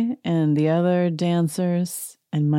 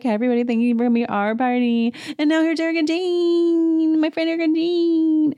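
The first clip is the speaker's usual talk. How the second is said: much higher, louder, rushed announcer-ish Kyle; hyped Kyle introduction; 11 semitones higher, 4 dB louder than usual. Retold or imitated. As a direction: gush it out to the crowd. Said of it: "She's like".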